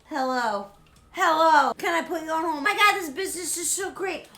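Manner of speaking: bright, loud, rushed shopkeeper juggling calls; hammed-up busy florist; busy shop owner on phone. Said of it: "people want to buy plants".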